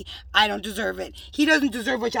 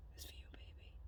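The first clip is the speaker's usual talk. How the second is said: whisper; 28 dB quieter than usual. Real, not acted. Whispering tenderly to unseen partner.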